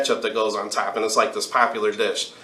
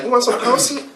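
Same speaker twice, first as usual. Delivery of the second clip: much higher, lilting imitation of the waitress; high mock waitress; 12 semitones higher than usual. Storyteller retelling the waitress's offer, much higher. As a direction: ask it like the sweet waitress, way up high.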